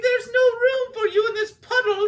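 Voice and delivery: high, loud, squawking bird voices shouting; birds turning him away. Acted. Birds turn Sammy away.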